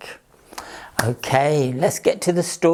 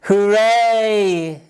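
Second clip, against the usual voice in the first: high, loud cheer; 6 semitones higher, 5 dB louder than usual. Acted. Farm animals cheering the rabbit's carrots, loud cheer. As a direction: celebrate the carrots. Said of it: "cheered the animals".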